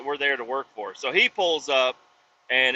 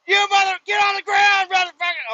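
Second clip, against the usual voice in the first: high, loud shout imitating an irate guard; hammed-up screaming guard; mock screamed orders; 7 dB louder than usual. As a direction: yell commands like a frantic security guard. Second guard screaming orders, retold.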